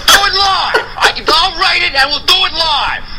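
Bill O'Reilly freak out. Retold or imitated. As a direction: rage at the teleprompter like the anchor. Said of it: "the Bill O'Reilly freak out".

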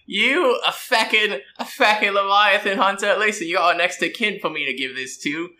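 put-on a cocky old Sparkrite scoffing; mocking Sparkrite. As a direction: sneer at the line bull like a cocky craftsman.

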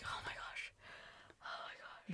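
whispered mock fright; very soft, voiceless retold panic; retold scared reaction. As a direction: re-enact being scared, in a hushed whisper. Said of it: "I was so scared".

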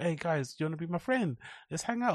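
put-on high, soft, sweet little-kid voice; innocent little kid. Small boy begging the kids in the park, soft and high. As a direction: ask like a timid small boy wanting friends. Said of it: "super innocent".

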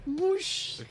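short spilling sound effect; imitated whoosh. Haley knocks the cup over, imitated whoosh.

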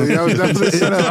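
laughing fast and loud. Real, not acted. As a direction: blurt it out through laughter. Laughing after being set up.

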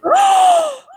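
sharp high gasp; loud startled intake of breath; shocked gasp. Real, not acted. Reacts to the shouted "don't do that.

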